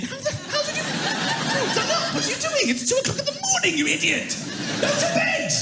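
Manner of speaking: very high laughing disbelief; exasperated disbelief; exasperated dad. Acted. Dad at 2am unable to believe his son is dressed.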